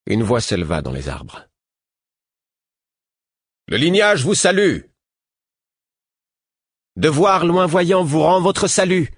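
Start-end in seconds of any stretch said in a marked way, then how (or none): 3.7-4.8 loud, high hail from the trees; raised ceremonial hail; calling out. Acted. Voice calls out from trees. 7.0-9.1 loud, high and slow ringing reply; formal hail called back; clear voice. Acted. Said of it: "répondit le prince d'une voix claire".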